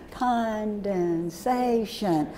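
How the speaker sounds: "Condensation" very slow, each syllable stretched apart. Shown as a demonstration. Teacher leading class through condensation, each syllable slowly drawn out. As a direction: draw out every syllable of "Condensation". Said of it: "so let's do condensation".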